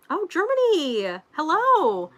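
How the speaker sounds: high, bright-voiced hello, drawn out; light up and wave hello through the mic; excited greeting. Real, not acted. Greeting a viewer from Germany, excited and high.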